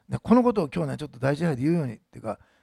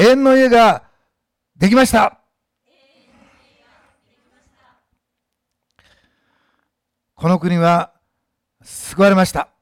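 slow loud declaration; 3 semitones higher, 11 dB louder, slower than usual. Shown as a demonstration. Pastor leading a slow, loud declaration for the church to repeat. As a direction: proclaim each phrase slowly and loudly. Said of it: "私の言ったスピードで言ってください。".

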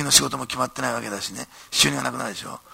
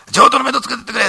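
high, loud scolding bark at Ito; boss shouting; 11 semitones higher, 9 dB louder than usual. Retold or imitated. Company president shouting at young Ito at the banquet, loud and high.

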